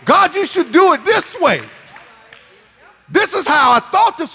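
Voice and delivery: demanding prayer. Acted. Preacher mimicking a believer dictating terms to God. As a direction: pray like you're giving God orders. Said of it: "we have a preconceived idea".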